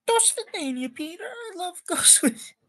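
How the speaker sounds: very high put-on mother voice; mom voice. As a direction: play the mom in a squeaky falsetto farewell. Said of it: "oh, that's the mom saying it".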